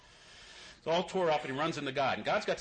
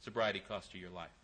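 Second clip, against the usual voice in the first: low, much softer, solemn God; 8 semitones lower, 9 dB quieter than usual. Retold or imitated. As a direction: say it low and soft, looking him in the eye. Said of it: "God looks him deep in the eye and goes".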